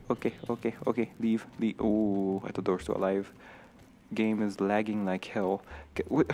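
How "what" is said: ordinary talk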